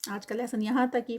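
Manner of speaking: normal talking voice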